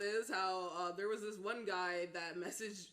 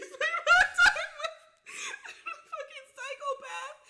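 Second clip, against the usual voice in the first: words squeezed out high through laughter; laughing; 10 dB louder than usual. Real, not acted. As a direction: laugh your way through it, much higher and louder. Laughing while retelling his rant.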